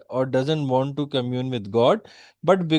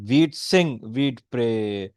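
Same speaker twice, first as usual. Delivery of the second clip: slow, measured verse reading, dropping lower; showing parallelism; 4 semitones lower, slower than usual. Shown as a demonstration. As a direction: draw out each parallel phrase, pausing after it. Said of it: "Listen to the parallelism over there".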